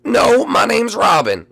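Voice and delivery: indignant bird. Acted. Bird insists on its name.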